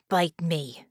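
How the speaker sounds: plain reading voice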